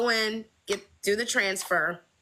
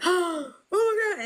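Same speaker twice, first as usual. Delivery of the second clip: high, slow delighted oh my God; thrilled gasp; 12 semitones higher, slower than usual. Retold or imitated. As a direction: let the joy burst out. Friend gasps at pregnancy news.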